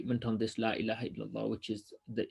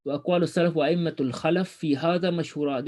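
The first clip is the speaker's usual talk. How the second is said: brisk, slightly higher reading of the classical text; formal text reading; reading Arabic text aloud; 5 semitones higher, 4 dB louder, much faster than usual. Acted. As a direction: read the Arabic passage straight through, quickly. Rereads the Arabic line.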